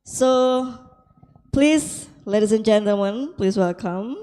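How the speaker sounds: ordinary delivery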